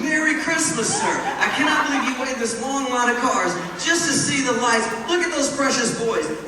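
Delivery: high, loud, exuberant holiday greeting; jolly Christmas well-wisher. Retold or imitated. Man handing candy canes to waiting family, loud high cheer. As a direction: gush it out over the noise. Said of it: "this guy's looking at my dad going".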